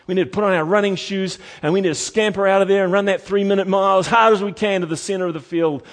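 loud, high, quick exhortation; urgent preaching. Real, not acted. Preacher urging us to scamper from the fence, urgent. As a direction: rush them away from danger. Urges congregation to run.

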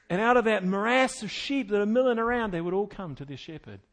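ordinary talk

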